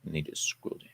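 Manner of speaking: quick voiceless note under the breath; whispered aside. Real, not acted. Host mutters to himself mid-read, whisper. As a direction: whisper it to yourself.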